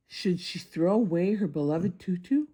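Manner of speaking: ordinary delivery, nothing marked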